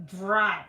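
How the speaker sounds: Dry said again, loud and plain; dry retried. Shown as a demonstration. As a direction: repeat Dry evenly. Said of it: "It's not Norwegian accent".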